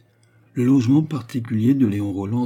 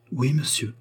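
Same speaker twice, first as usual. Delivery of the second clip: higher, slightly softened humble reply; 5 semitones higher than usual. A narrator's reading. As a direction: answer meekly, with light acting. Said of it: "répondit-elle humblement".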